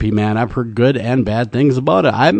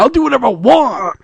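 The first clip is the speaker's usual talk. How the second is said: high whiny brat voice; bratty whine; 10 semitones higher than usual. Acted. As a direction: whine it like a spoiled teen. Mock defiant brat voice.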